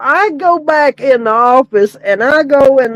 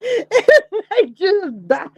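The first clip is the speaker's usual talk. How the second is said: slow, high, through real laughter; laughing; 6 semitones higher, 4 dB quieter, slower than usual. Real, not acted. Telling the audience how her staff cracked up, laughing. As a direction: laugh the words out softly.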